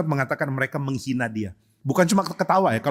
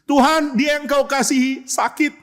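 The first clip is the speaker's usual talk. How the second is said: pleading; 9 semitones higher, slower than usual. Acted. Acting message pleading to Jesus.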